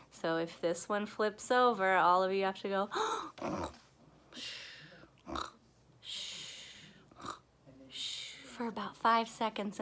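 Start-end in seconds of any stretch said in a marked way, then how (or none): 4.3-8.3 soft snore with a shushing exhale; hushed mock snoring; pretend sleeping. Shown as a demonstration. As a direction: gasp in, then breathe out "shh" like sleep. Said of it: "you have to fall asleep".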